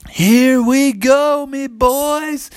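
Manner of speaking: slow, high, drawn-out holler; rally your crew with gusto; high dinosaur call to charge; hearty rallying cry. Acted. T-Rex sets off with riders.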